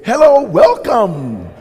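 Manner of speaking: imitating a shop greeter welcoming customers; store greeter. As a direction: sing out a doorway greeting. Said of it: "they say".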